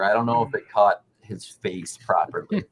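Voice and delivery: ordinary delivery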